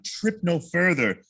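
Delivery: slow, drawn-out sweet talk; slow, charming recitation; wooing storytelling. Shown as a demonstration. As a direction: woo her, stretching each word. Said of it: "you gotta help him tell".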